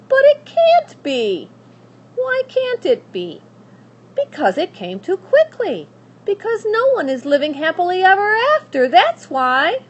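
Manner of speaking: high, loud, rushed complaint; protesting child. Acted. Abilene arguing with Pellegrina, high and loud. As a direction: argue like an upset girl.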